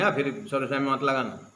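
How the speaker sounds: normal talking voice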